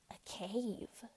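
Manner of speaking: soft whispered answer; mysterious whisper. Acted. A lotus eater telling Polites where food is, whispered. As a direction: hush it like a secret.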